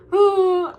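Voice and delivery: loud, drawn-out groan of frustration; exasperated groan. Real, not acted. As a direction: let out a big fed-up groan. Loud groan while venting.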